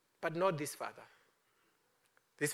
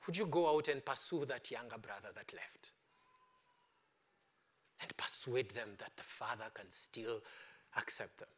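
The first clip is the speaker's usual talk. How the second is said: whispered appeal; 6 semitones lower, 8 dB quieter than usual. Acted. Intimate appeal to listeners.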